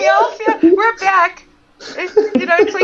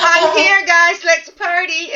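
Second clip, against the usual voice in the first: louder, rowdy arrival call; loud party entrance; 5 semitones higher, 7 dB louder, slower than usual. Retold or imitated. Picturing Freddie Prinze Junior arriving, loud rowdy greeting. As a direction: barge in shouting the party on. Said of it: "good God".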